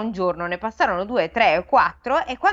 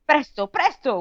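mild shout; 7 semitones higher than usual. A narrator's reading. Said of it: "urlò lo zar Dadon".

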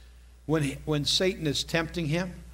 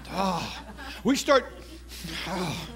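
ordinary talk, nothing marked

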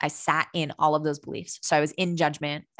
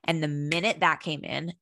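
usual speaking voice

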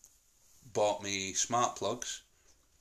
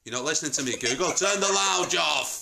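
exasperated command; 6 semitones higher, 5 dB louder than usual. Real, not acted. Dad telling off Google for not listening, exasperated. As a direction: scold the device, then drive each word home. Repeats command to ignored smart speaker.

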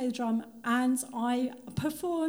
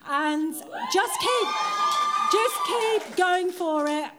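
slow, loud, drawn-out push to keep going; rousing crowd urging; emphatic urging; 8 semitones higher, 5 dB louder, slower than usual. Real, not acted. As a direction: shout it out slowly like a rallying cry. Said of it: "actually just go for it".

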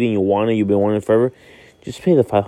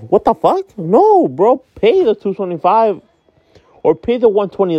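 exasperated outburst; 8 semitones higher than usual. Real, not acted. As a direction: snap back at the lowballer. Ranting at lowball sneaker buyers.

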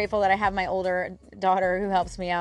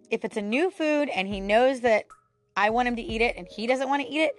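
ordinary talk

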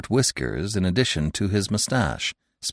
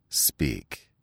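low, softer curt command; gruff inspector; 12 semitones lower, 8 dB quieter than usual. A narrator's reading. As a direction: bite off the order. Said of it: "he said".

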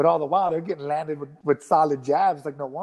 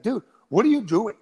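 higher-pitched imitation of an irritated challenge; mock aggressive scolding; 6 semitones higher, 4 dB louder than usual. Sparring partner confronting him mid-round, imitated aggressive scolding. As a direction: imitate a sparring partner getting on his case. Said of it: "real, like, aggressive".

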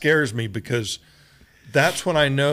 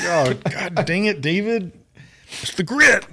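imitating crying; 7 semitones higher, 5 dB louder than usual. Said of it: "It's like".

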